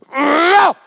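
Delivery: high, loud cry of "No!"; sudden cry. Retold or imitated. Preacher sensing a demon in the room, loud high cry. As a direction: let out a sharp high cry. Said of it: "I get this".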